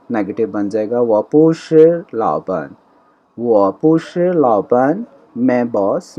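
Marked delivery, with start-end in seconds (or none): 1.0-2.7 وہ پوشر لاپن said slowly, leaning on پوشر; teacher slowly models the negative sentence; slow model Chinese. Shown as a demonstration. Unhurried careful demo. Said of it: "slow negative model". 3.4-5.0 وہ پوشر لاپن said slowly, rising at the end; teacher repeats the negative sentence for students; slow model Chinese. Shown as a demonstration. Drawn-out rising demo.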